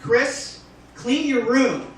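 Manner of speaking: mock stern father figure. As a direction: bark the order at a kid. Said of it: "I'll demonstrate".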